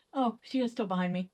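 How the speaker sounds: ordinary talk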